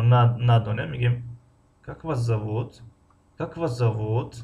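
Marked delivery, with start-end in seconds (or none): 1.8-2.8 slow, зовут drawn out at the end; weight on the final зовут; rising ending. Shown as a demonstration. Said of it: "охири ҷумларо баланд талаффуз кардем". 3.4-4.4 slow, зовут drawn out at the end; weight on the final зовут; rising ending. Shown as a demonstration. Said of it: "охири ҷумларо баланд талаффуз кардем".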